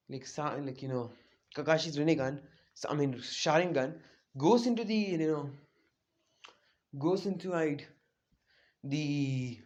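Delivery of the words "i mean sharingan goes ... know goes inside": usual speaking voice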